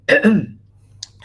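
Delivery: coughing mid-sentence; chesty cough. Real, not acted. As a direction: clear the throat with a cough. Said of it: "Sorry, excuse me, this, this cough".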